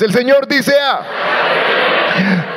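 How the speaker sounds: slow, shouted collective reading; slow, loud recitation; strong loud reading. Real, not acted. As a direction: belt it out together. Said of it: "Vamos todos fuertes".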